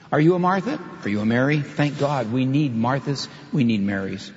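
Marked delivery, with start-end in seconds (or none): none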